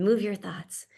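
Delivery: ordinary talk, nothing marked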